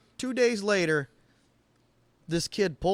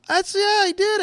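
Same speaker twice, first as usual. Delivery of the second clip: much higher and louder, exasperated; mock outraged self-defense; indignant outburst; 7 dB louder, slower than usual. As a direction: protest your innocence loudly. Retells his own indignant reply.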